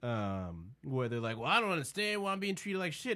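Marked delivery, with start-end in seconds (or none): 1.4-3.1 put-on high, quick childlike complaint; mock whiny. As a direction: imitate a whining baby of a grown-up. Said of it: "the whiny ass titty baby syndrome".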